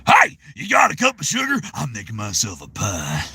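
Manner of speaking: folksy Southern character voice; comic Southern intruder asking a neighbourly favour; Bob. Acted. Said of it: "Bob sounds like those type of people".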